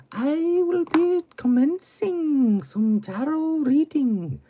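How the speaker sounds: high, slow put-on Scottish mystic; drawn-out, high-pitched Scottish hostess voice; Scottish accent. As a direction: welcome them in a very high Scottish lilt. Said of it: "I chose a Scottish accent for that".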